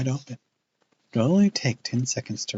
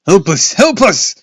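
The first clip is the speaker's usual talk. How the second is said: high, loud screamed plea; pleading scream; 11 semitones higher, 13 dB louder than usual. A narrator's reading. Clay screams for help among bats. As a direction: plead at the top of your lungs. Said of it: "plead at the top of his lungs".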